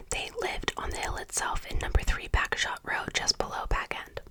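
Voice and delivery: plain reading voice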